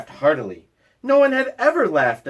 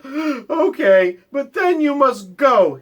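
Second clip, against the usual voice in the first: high, sniffly and tearful; a bear fighting back tears; sniffling; 9 semitones higher, 4 dB louder, slower than usual. Acted. Bear sniffles as he lets the mouse in. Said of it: "he sniffed".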